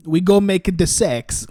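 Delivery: put-on laughing Borat-style accent. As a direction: ham up the Borat catchphrase. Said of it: "Well, that was Borat".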